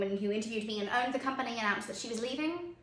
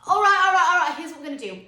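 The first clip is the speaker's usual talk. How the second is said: loud, high start, easing down; calling to order; 5 semitones higher, 9 dB louder, faster than usual. Acted. Parent setting terms for playtime, loudly taking charge. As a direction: cut over noisy children, then settle. Parent settling noisy children.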